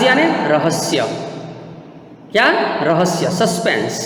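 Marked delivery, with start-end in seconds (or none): none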